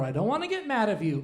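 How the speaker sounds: normal talking voice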